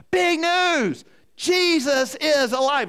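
high, loud announcement, last word stretched out; imitated kids' shout. Grandchildren suddenly stop mid-play to announce it, shouting high. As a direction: proclaim it like an excited grandchild. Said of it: "screaming out".

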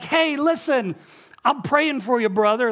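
high, loud imitation of a hearty neighbor; calling out. Neighbor encouraging the pastor across the street, calling out. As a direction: call it over the road to get attention.

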